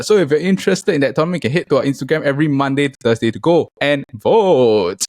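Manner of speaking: ordinary talk, nothing marked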